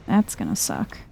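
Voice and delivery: normal talking voice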